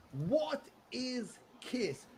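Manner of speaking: drawn-out puzzled question. Retold or imitated. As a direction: stretch it out in mock disbelief.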